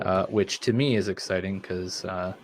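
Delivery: normal talking voice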